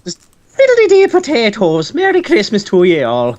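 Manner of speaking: put-on jokey stage Irish accent; hammed-up Irish lilt. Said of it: "Irish New Year".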